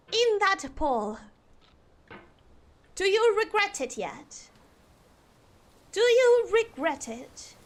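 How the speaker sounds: attempted cut-glass British English; amateur Queen's English, carefully enunciated; posh British accent.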